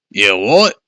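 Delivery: startled cry. Acted. Father crying out at the monster's answer, startled. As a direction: bark it in disbelief. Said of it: "Dad cried".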